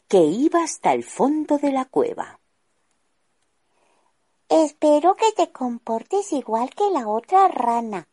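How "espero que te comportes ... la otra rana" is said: high, louder sly gnome voice; sorcerer gnome. Acted. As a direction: warn the captive frog smugly. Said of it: "le dijo el gnomo hechicero".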